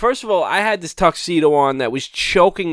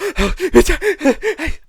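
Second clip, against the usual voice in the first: long, high gasping breaths; acted panic gasp; 11 semitones higher than usual. Groom retelling his freak-out at the altar, mock gasping for air.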